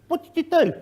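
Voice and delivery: high, sharp questioning voice of an inspector; mock inspector question. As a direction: put the question to them like a pointed official.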